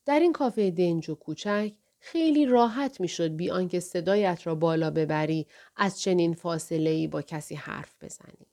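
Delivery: usual speaking voice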